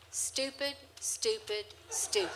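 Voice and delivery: lightly voiced parrot muttering insult; a hint of a scornful bird. Blanca insulting the doubting hostess, lightly voiced parrot. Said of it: "his response was".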